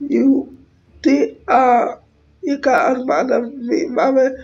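normal talking voice

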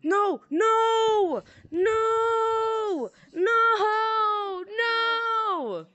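high, long, wailing no; drawn-out wail. Pretend. Player crying out no over and over in the game, wailing. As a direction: stretch each cry out high like a mock lament. Repeated drawn-out cries during play.

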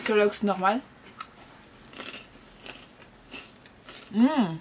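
chewing while talking; tasting cereal then speaking; mouth full. Real, not acted. Seen 0: She puts cereal in mouth at -4, closed-mouth grimace continues early.

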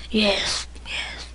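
hushed, whispered soldier voice; throat-damaged soldier. Acted. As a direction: barely get the words out. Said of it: "can barely talk".

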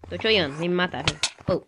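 normal talking voice

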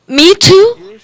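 surprised. Retold or imitated. Woman amazed she is included.

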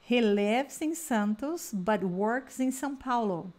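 slow, clear drill sentence; slow drill model. Shown as a demonstration. Teacher leading a repeat-after-me drill, slow. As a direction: model it slowly so they can repeat. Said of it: "Vamos repetir essas frases".

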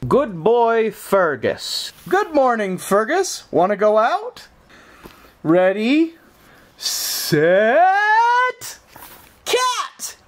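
high, sing-song calling the dog; high dog-owner voice. Acted. Owner calling Fergus to go outside, sing-song. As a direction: coax the dog out cheerfully.

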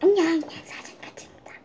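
whispered ASMR greeting; breathy unvoiced whisper. Shown as a demonstration. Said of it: "에이에스알을 시작하겠습니다".

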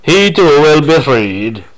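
put-on slow, drawn-out Romanian-style accent; mock Romanian accent. Fan mimicking how Rassilon talks, odd Romanian-like accent. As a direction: imitate a weird, vaguely Romanian accent, taking your time. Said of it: "weird, like a Romanian or something".

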